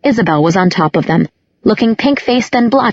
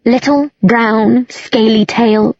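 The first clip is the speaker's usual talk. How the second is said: slow, higher, drawn-out words; slow tipsy girl; tipsy description; 4 semitones higher, slower than usual. A narrator's reading. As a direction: list it slowly and drunkenly. Tipsy Isabel describes the rat.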